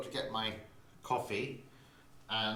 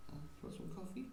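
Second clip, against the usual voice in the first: soft question to the cat; 7 semitones higher, 11 dB quieter, faster than usual. Real, not acted. Asking the cat softly.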